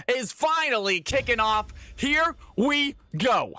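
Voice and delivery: big hyped announcer voice, drawn out; slow, lifted tournament announcer hype; announcer kickoff. Acted. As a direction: call the start like an arena host.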